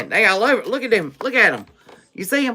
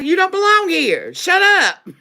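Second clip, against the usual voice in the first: mocking the doubters; 8 semitones higher than usual. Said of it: "They're like".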